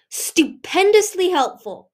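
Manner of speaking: slow, high, loud sarcasm; sarcastic. Acted. Peril mocking her father, slow sarcastic praise. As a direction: drag it out to mock his help.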